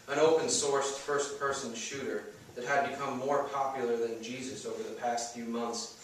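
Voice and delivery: plain reading voice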